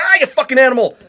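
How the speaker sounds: high, loud pained outburst through a laugh; furious yell. Acted. Balaam yelling at the donkey that crushed his leg, furious. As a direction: snap at the beast through the pain.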